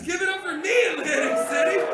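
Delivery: loud, high shout to the crowd; calling for applause. Real, not acted. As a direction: whip up the audience at full volume.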